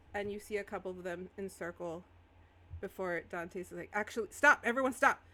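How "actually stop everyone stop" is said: sudden loud order to halt; raised, urgent in-character shout; shouted command. Acted. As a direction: call out sharply over the chaos. Dante shouts to halt everyone.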